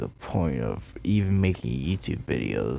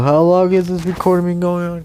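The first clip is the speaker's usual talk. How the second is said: ordinary delivery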